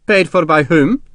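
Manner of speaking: stern demand. A narrator's reading. Colonel questioning his sergeant about payment, stern demand. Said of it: "McCandless demanded sternly".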